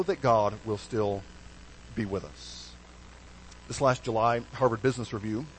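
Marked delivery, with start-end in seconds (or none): none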